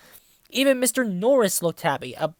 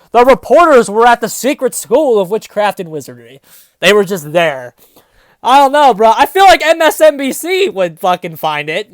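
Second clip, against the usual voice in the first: loud, disbelieving rant; exasperated outburst; 12 dB louder than usual. Real, not acted. Reader ranting about reporters at Hogwarts, loud and exasperated. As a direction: throw up your hands and yell it.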